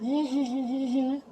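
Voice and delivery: high, drawn-out put-on giggle; mock giggle. Reviewer acting out her joking around, mock giggle. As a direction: imitate someone goofing around with a tittering laugh. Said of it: "she's just joking around".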